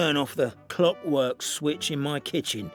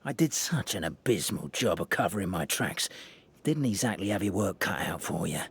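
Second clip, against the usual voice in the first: smug villain; 8 semitones lower, 3 dB quieter than usual. Acted. Culprit's smug confession.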